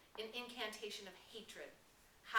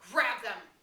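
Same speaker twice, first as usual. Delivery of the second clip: loud, sharp command; barked order; 4 semitones higher, 14 dB louder than usual. Acted. Jack ordering his tribe to grab the twins, loud. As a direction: order the tribe to seize them.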